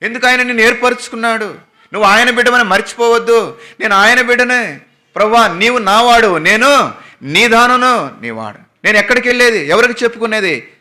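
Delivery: high-pitched, fervent preaching; fervent sermon delivery. Acted. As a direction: lift the congregation with fervour. Preaching at full force.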